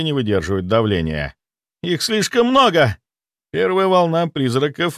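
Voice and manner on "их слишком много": high desperate cry; panicked cry. Acted. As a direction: cry out that it's too much. Young mage cries out in strain.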